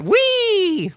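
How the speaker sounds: high, joyful "Whee!" squeal; gleeful whee. Retold or imitated. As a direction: squeal it like a thrill ride. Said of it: "I went".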